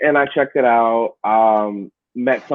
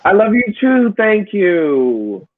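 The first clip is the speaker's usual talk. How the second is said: higher and louder, beaming back at a fan; a real happy thank-you to the viewer; delighted reply; 7 semitones higher, 3 dB louder, slower than usual. Real, not acted. Answering a fan's comment.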